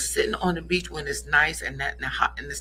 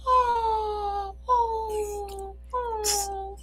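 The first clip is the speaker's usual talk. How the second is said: high gasping oh oh oh; high, breathy crowd swooning over the proposal; much slower than usual. Retold or imitated. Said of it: "everybody was like".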